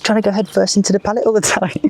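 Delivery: high, hammed-up Welsh accent; mock Welsh angler griping to the fishery manager; [name]'s Welsh accent. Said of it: "not got a very good Welsh accent".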